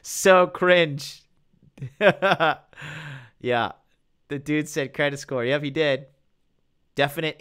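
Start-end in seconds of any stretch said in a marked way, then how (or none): 1.8-2.6 short loud laugh; real laughter. Real, not acted. Laughing at something cringe.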